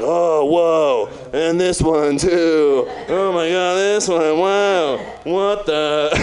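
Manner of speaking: drawn-out fake wonder; hammed-up astonishment; mock dramatic amazement. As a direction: marvel theatrically at each new find. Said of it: "pull out thirty-eight Bibles dramatically".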